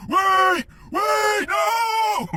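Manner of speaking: high, very loud yell after someone; desperate shout. Retold or imitated. Friend calling after him down the street, desperate shout. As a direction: shout it out like you're chasing a runaway friend. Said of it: "My homie's behind me, running down the street like".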